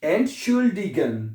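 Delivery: "Entschuldigen" slowly, part by part: Ent, schul, di, gen; teacher spelling out "Entschuldigen" by slow syllables; broken into parts. Shown as a demonstration. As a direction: draw out each syllable of "Entschuldigen". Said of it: "ऐसे पढ़ लें".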